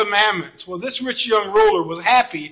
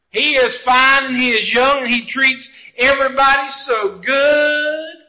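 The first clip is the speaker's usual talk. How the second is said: slow, louder Southern drawl, hamming up the admiration; 3 dB louder than usual. Pretend. A preacher voicing the congregation gushing over a fine young man in a Southern drawl. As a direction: gush about him in a drawn-out Southern accent. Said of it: "got a southern drawl like that".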